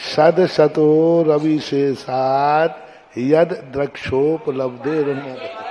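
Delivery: Sanskrit verse chanted slowly, syllable by syllable; slow teacher's chant of the verse; slow recitation demo. Shown as a demonstration. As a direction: recite it slowly for the class to follow. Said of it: "कैसा पढ़ते सब लोग का देखो पढ़ना".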